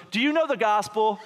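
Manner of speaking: put-on high-pitched little-kid question; five-year-old girl. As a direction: pipe up like a bold small child. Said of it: "My five-year-old goes".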